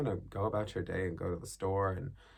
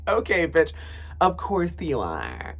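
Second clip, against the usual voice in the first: higher, louder, slow sassy drawl; sassy mock reply; 7 semitones higher, 8 dB louder, slower than usual. Teen sassing back at mom, higher and louder with a laugh. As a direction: toss it off with diva attitude. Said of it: "I'm like".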